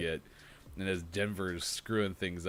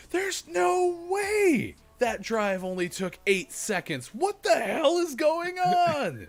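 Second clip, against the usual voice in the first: slow, high outraged yell at the clock; frustrated shout; 8 dB louder, slower than usual. Retold or imitated. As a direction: bellow it like a baffled fan. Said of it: "and I'm like".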